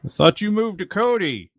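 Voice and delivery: high-pitched shout from below; calling out. Acted. Hidden man answering from the stairwell, high call. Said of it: "a voice calls out".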